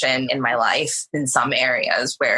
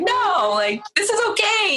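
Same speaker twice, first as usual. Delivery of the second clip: excited insistence. Retold or imitated. Insisting passion is fine.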